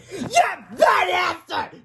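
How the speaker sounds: high, slow blubbering wail; crying wail. Acted. Melvin wailing after the spanking. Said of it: "Melvin cried".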